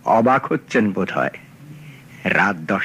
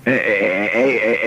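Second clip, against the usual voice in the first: stumbling, stammering on the first sound; nervous stammer; 2 semitones lower, much slower than usual. Acted. Collector nervously offering the detective money, stammering. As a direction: falter awkwardly before handing it over.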